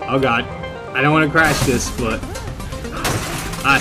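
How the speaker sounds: ordinary delivery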